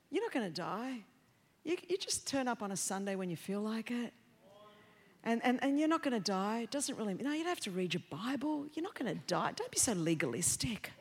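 low, hushed coaxing voice; soft devil voice. Retold or imitated. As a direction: murmur the lie smoothly. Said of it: "what the devil's trying to say".

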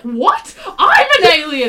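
high, loud cry of disbelief; shocked outburst. Acted. Anderson blurts out his own alien secret to the others, shocked shout. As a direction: shout it in comic astonishment. Acted shocked character in retold scene.

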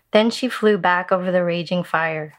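ordinary delivery

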